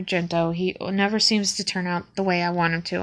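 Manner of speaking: ordinary talk, nothing marked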